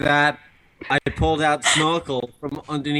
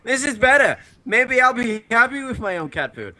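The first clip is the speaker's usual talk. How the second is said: excited; 9 semitones higher than usual. Retold or imitated. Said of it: "And I was like".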